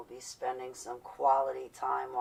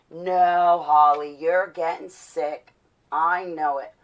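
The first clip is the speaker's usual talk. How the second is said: loud, drawn-out mock insistence; imitated stubborn insistence; 12 dB louder, slower than usual. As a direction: act out someone loudly refusing to believe it. Acted insistence in retold argument.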